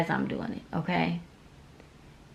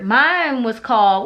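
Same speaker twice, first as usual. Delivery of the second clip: ordinary talk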